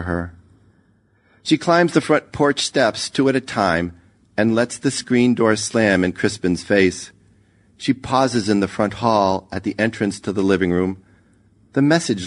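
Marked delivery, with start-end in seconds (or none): none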